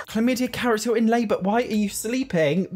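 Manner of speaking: high, giggly exasperation at the horse; real amused squeals; laughing disbelief. Real, not acted. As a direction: laugh while pleading. Horse asleep during labor.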